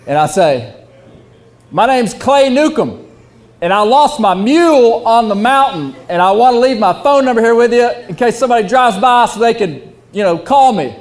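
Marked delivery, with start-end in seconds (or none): 1.7-11.0 raised voice over loud bar music; shouting to the bartender over loud music; yelling over music. Retold or imitated. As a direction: call it out over the noise. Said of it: "I'm yelling 'cause the music's loud".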